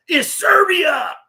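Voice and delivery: loud, high, drawn-out shout; imitated yell, slow and soaring in pitch; mock crowd yell. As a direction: bellow it back like twenty outraged Serbs. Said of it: "you'll hear twenty of 'em yell".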